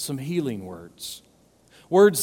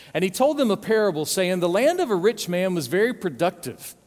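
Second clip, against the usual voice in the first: raised, brisk Bible reading; scripture reading; 4 semitones higher than usual. Acted. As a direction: read the verses with weight. Reads scripture aloud.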